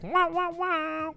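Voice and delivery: very high, slow wah wah horn; club horn imitation. Shown as a demonstration. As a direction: blare it like a club air horn. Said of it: "I just do it like".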